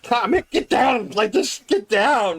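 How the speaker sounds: high, slow get down; urgent command. Acted. Player ordering guards down, slow high urgent yell. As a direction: drag out the order.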